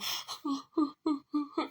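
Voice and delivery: high, short boo-hoo sobs; mock dainty crying. Shown as a demonstration. Showing what the crying was not, mock whimpering. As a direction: imitate a small, polite cry in little bursts. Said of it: "And it's not no".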